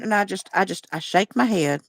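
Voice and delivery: ordinary talk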